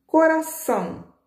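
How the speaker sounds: Coração stretched out high and slow; teacher's question word. Shown as a demonstration. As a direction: lift Coração high, slow, like asking kids. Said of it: "tá no aumentativo?".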